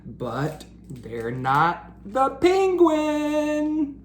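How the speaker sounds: high, slow, drawn-out whine; disappointed. Acted. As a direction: sulk that it's not the penguin. Splat disappointed.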